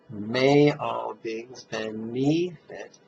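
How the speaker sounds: slow, with "May" stressed and falling; emphasized recitation. Shown as a demonstration. Teacher showing the smile-inducing way to say the mantra, slow and stressed. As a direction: stretch the words, landing on "May". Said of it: "when you recite it verbally that way".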